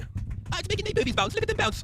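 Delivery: very high, giddy squeal; Harley squealing about bouncing; squeaky girl voice. Pretend. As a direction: squeal it with delight. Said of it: "impression ... horse riding".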